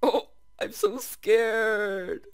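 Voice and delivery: hammed-up scared wail, high, loud and slow; mock scared. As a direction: stretch it out in a playful fake fright. Mock fear while mining.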